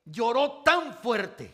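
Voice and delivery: slow and loud, leaning hard on "tan"; preacher pounding "tan fuerte"; stress on tan. Acted. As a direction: drag out "tan fuerte" for weight. Preacher repeating for emphasis.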